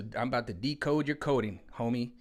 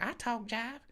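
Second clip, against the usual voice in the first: put-on much higher sweet old-lady voice; little old lady; 12 semitones higher, 4 dB quieter, slower than usual. The little old lady from Airplane answers, high old-lady voice. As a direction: pipe it up like a tiny old lady. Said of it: "the little lady got up and was like".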